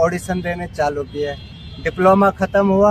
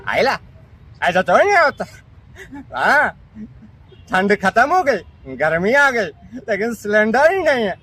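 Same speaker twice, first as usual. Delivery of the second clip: childlike voice impression; 3 semitones higher than usual. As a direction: squeal it like a wide-eyed kid. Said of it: "वैसी एक unique voice है".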